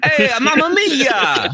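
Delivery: high, slow hammed-up Italian accent. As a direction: play up a mock Italian accent. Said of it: "how would an Italian man say that".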